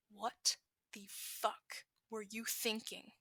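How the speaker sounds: slow, held-back fury; seething. Acted. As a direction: grind it out, barely containing rage. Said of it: "Katsuki seethes".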